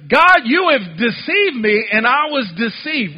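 preached scripture reading. Acted. Preacher rereads the verse to the congregation, preaching forcefully. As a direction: draw out the prophet's complaint forcefully.

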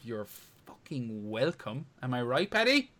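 ordinary delivery

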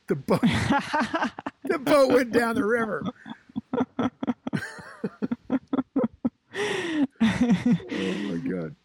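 can't stop laughing telling it; giggling at the boat floating off. Real, not acted. Laughing at boat washed away.

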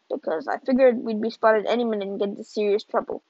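plain reading voice

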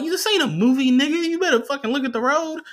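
high-pitched mock outrage; exasperated scolding. Imagined scolding of the driver.

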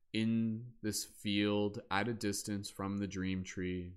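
slow, soothing meditation voice with long pauses; guided meditation. Acted. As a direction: ease them into stillness, unhurried. Continues guided body-scan meditation.

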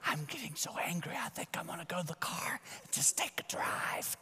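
demonstrating a strained, high angry whisper. Shown as a demonstration. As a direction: whisper it as if holding back rising anger. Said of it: "then I start whispering".

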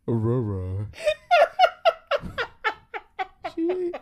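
low, loud playful roar; hammed-up roar; mock lion roar. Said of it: "Hey, roar, please".

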